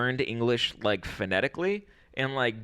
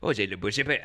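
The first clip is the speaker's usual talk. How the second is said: imitated French grumbling; mock French; much faster than usual. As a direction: mimic a French couple talking trash. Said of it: "I heard them being like".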